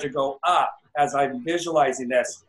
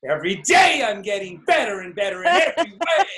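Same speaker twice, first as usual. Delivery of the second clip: put-on enthusiastic old mentor; 3 semitones higher, 4 dB louder than usual. Coach imitates mentor Don's hearty affirmation. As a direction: belt out the affirmation like a keen old man. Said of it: "And Don's all".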